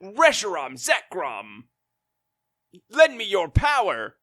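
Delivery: high, loud hammed-up heroic summons; mock heroic call. Host voicing Damon summoning the dragons, hammed-up heroic. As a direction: call on the legends like an anime hero. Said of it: "And he's like".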